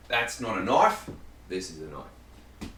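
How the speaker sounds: a hammed-up, low, bad Australian accent on the second line; mock Aussie, bad on purpose; awful Australian accent. Cooking vlogger jokes with a knife, in a bad Australian accent. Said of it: "awful Australian accent".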